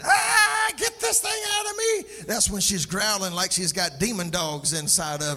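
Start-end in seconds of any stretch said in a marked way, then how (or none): 0.0-2.2 high-pitched shriek of a woman in labor; hammed-up screaming, pitch way up; mock scream. Mother in birth transition wanting baby out, mock scream. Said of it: "That's when she's screaming".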